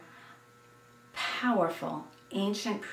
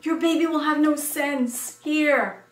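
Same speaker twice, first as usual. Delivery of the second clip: higher and louder urgent alarm; alarmed warning; 7 semitones higher, 7 dB louder than usual. A narrator's reading. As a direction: warn her in a sudden panic. Mother alarmed about sewing superstition.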